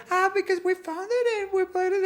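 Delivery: very high imitation of the girls; mock girl explaining herself; girls' voice. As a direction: put on a high-pitched girly reply. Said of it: "the girls went".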